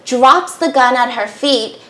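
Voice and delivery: ordinary talk, nothing marked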